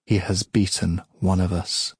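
plain reading voice

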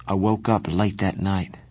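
plain reading voice

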